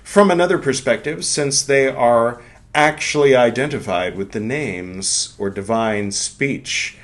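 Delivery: a measured lecture-reading voice; reading aloud. Acted. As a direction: read it with gravity. Reads the book aloud.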